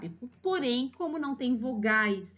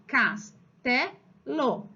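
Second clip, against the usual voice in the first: very slow, CASTELO split into cas-te-lo; syllable by syllable; much slower than usual. Shown as a demonstration. Teacher showing how the child wrote castelo, syllable by syllable. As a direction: separate each syllable of CASTELO with a pause.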